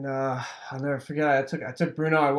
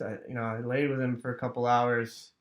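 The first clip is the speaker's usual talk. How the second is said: ordinary delivery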